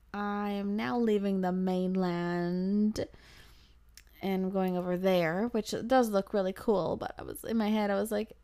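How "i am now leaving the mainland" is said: slow, drawn-out inner announcement; anxious realization. Retold or imitated. Uneasy passenger to herself leaving the mainland, slow drawn-out realization. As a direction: stretch each word as dread sinks in. Anxious thought while boarding water taxi.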